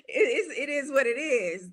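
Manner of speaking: a real half-laugh, half-cry; laughing through tears. Real, not acted. Speaker laughing, moved, about adopting Gracie. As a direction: let the laugh crack with feeling. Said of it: "laugh cry".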